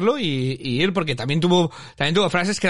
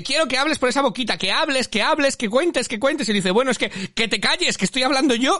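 much higher, insistent, nagging; mock shrill scolding; 8 semitones higher than usual. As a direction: mimic her demanding he talk, then cutting him off. Quoting Lucía scolding Manuel. (imitando a Lucía exigiendo y luego mandándolo callar, aguda)

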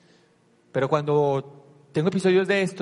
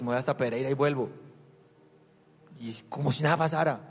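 ordinary talk, nothing marked

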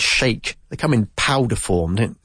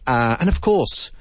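normal talking voice

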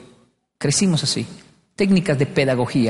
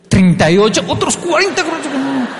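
panicked wrong answer; 9 semitones higher than usual. Retold or imitated.

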